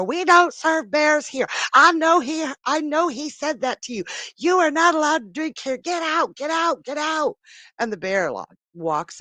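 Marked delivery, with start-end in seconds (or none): 0.0-7.4 high, shouted scolding in a joke; mock screaming. Woman ordering bear out of bar, mock screaming. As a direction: screech at him like an outraged woman, hammed up. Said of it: "she starts screaming at the bear".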